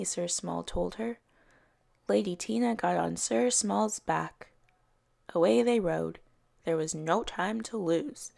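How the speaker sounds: ordinary delivery, nothing marked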